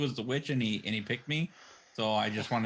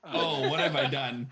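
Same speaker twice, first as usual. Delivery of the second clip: mock dismay; 3 semitones higher, 4 dB louder, slower than usual. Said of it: "Jason's like".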